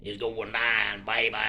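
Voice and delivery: slow, low, laid-back rapper line. Retold or imitated. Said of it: "be like [name]".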